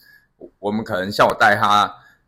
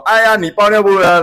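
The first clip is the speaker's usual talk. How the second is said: loud, high teasing jeer; teasing hype; 9 semitones higher, 7 dB louder than usual. Retold or imitated. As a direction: egg the loser on like a rowdy classmate. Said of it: "旁边可能要有人起哄".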